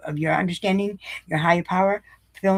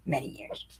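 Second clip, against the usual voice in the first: whispered echo; 3 semitones lower, 11 dB quieter than usual. Real, not acted. Repeats phrase, fading to whisper.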